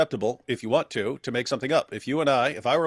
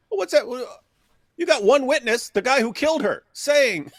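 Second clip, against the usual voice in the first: much higher and louder disbelief; incredulous outburst; 12 semitones higher, 5 dB louder than usual. Real, not acted. Reacting to the hadith for the host, loud incredulous outburst.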